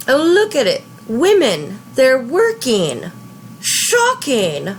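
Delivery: high-pitched sarcastic scandalized voice; hammed-up scandalized disbelief; mock shock. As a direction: feign horror, pitch jumping up. Mock outrage at women working.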